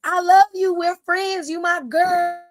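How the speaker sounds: high, loud pleading call after a friend; hammed-up desperate yell, begging her not to leave; mock screaming plea. Naomi pleading as Bianca walks out, mock screaming. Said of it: "Naomi started screaming".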